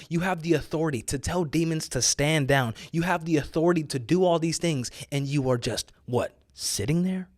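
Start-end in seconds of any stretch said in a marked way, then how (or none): none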